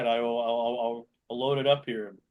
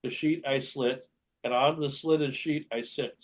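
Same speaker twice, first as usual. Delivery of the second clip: slow, deliberate tongue twister; slow careful reading; 4 dB quieter, slower than usual. Shown as a demonstration. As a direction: enunciate each word slowly. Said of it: "So the first one is".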